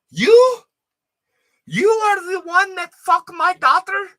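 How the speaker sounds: put-on high, loud accented shriek at the roommate; angry Albanian mom. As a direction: screech it like a furious immigrant mother.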